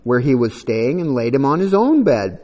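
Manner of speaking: solemn Bible reading; scripture reading. Acted. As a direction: read the passage out to the church. Reads scripture aloud.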